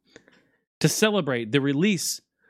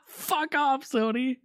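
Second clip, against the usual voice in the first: gloating jeer; 10 semitones higher, 3 dB quieter, slower than usual. Real, not acted. Taunting Sony again.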